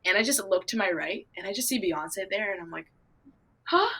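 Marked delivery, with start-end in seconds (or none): 3.7-3.9 high-pitched double take; stunned disbelief. Retold or imitated. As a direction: squeak it in starstruck shock, with a pause. Acts out spotting a star.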